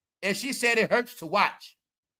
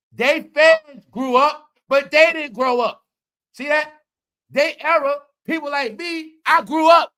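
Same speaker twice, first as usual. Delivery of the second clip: building rant; 8 semitones higher, 8 dB louder, slower than usual. Real, not acted. Ranting about fans who never grew up.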